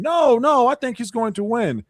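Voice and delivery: high, loud opening no's; mock the panel's high-pitched objection; berating protest. Panel berating her for doubting a candidate, high-pitched protest. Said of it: "all of you berated me saying".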